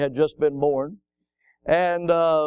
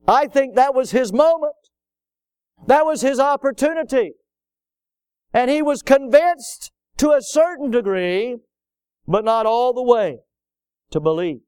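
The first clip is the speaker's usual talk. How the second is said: higher, louder preacher's climax; preaching at full force; 7 semitones higher, 5 dB louder than usual. Acted. Preacher lamenting Agrippa's missed moment, rising fervent preaching. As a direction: drive it home from the pulpit.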